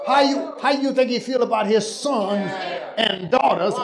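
slow, rising shouted sermon; fiery preaching. Acted. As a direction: preach it up to the rafters. Preaching at full force.

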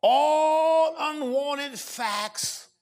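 drawn-out words, each one weighed; slow weighty proclamation. Real, not acted. Preacher slowly stressing each word for notes. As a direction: make them write it down.

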